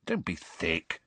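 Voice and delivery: usual speaking voice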